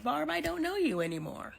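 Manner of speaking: a hint of a baffled husband; bewildered husband. Husband staring at his changed wife, lightly bewildered. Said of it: "you looking at her like".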